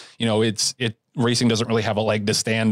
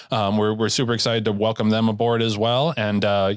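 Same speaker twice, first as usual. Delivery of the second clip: ordinary delivery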